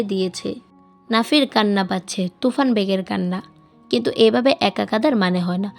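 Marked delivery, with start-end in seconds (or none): none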